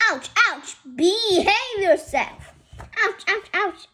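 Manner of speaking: sharp high ouch cries; pained yelps. Acted. Stung by bees, high pained yelps. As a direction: yelp at each sting.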